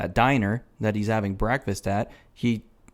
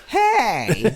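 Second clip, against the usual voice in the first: high, loud drawn-out hey; mock call-out; 11 semitones higher, 5 dB louder than usual. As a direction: imitate calling out with a big lifted voice. Imitates woman being greeted.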